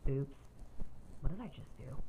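small, low and quiet retold oops; retold sheepish self-talk; sheepish aside. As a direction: drop it low and soft, as if caught out. Retold awkward moment after silence.

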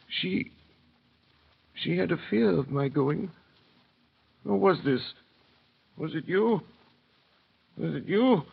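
put-on trembling old prisoner begging an answer; overwhelmed recognition. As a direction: reach out to a memory. Manette recognising his daughter.

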